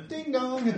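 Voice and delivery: doorbell imitation.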